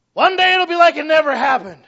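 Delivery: high, loud mock of a funeral platitude; mocking loud quote. Quoting a funeral comforter, loud high mock. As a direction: throw the cliché back, loud and high.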